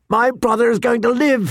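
elated announcement, pitched high; joyful. A narrator's reading. Jon runs downstairs to tell the guards, joyful. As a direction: share it high, bursting with joy.

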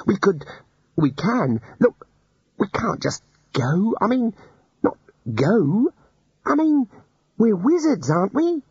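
high, stammering pleading student; flustered young wizard. Acted. Ponder begging the wizards to stay, high and flustered. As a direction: plead with the elders, tripping over words.

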